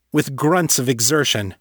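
ordinary delivery, nothing marked

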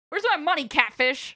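high-pitched, insistent shakedown; demanding thug. Acted. Thug busts in demanding money.

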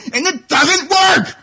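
high, slow, shouted complaint; frustrated shout. Real, not acted. Caller rants about his printer, a high slow shout. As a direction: let the rage burst out.

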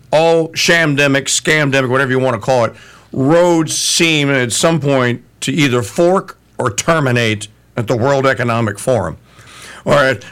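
ordinary talk, nothing marked

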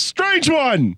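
high, falling mock holler; mock yell. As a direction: imitate an elder crying out. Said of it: "he doesn't yell that".

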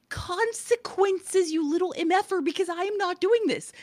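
high, retold telling-off; exasperated scolding. Mom laying down consequences to son, exasperated scolding. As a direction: pitch it up and draw out the first word.

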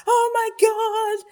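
high, loud, slow mock teenage wail; mocking her own teen-pregnancy panic, high and loud. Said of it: "this real kind of particular script".

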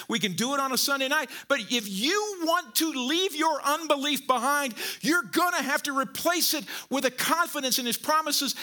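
high, insistent preacher delivery, stretching key words; preacher urging congregation to remember God's promises, high and impassioned; impassioned preaching. Real, not acted. Preacher urging the congregation, impassioned. Preacher raises to full force.

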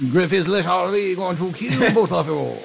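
hammed-up French accent, pitched high; mock French accent, high and sing-song. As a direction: say it in a comic, lilting French accent. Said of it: "Can you say that in French?".

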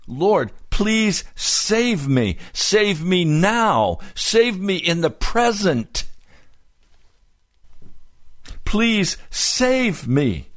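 desperate plea. Retold or imitated. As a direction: stretch it out, begging to be rescued now.

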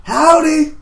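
high, loud hollered howdy; hollered greeting. Acted. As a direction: holler it across the water. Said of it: "I holler out towards the ladies".

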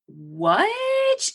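high, drawn-out "What?"; shocked disbelief. Retold or imitated. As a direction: stretch it upward in stunned disbelief. Shocked reply to bike accident news.

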